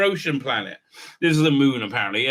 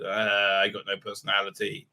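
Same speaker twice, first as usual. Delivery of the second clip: lazy line reading; 8 semitones lower, 5 dB quieter than usual. Retold or imitated. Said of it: "lazily say these lines".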